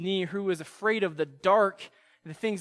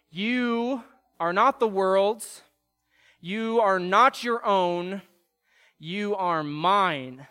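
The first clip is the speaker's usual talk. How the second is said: slow emphatic; slower than usual. Acted.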